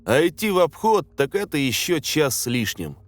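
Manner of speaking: ordinary delivery, nothing marked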